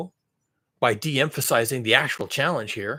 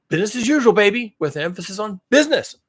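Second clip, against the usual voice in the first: high, loud sneering slogan; sarcastic raised voice; mock slogan; 6 semitones higher, 5 dB louder than usual. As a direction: sell it like a smug pitch. Mocking slogan.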